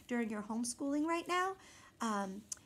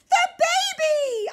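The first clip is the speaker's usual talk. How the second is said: much higher, very loud slow yell; frantic yell; 13 dB louder, slower than usual. A narrator's reading. Boy yelling for his missing baby sister. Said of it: "I yelled louder than anyone".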